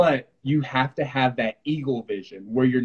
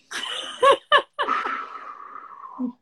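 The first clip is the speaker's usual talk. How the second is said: airy, high unvoiced laugh; breathy laugh. Choosing calm after the kick, a breathy laugh. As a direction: laugh the pain off. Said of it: "what I chose to do was just like".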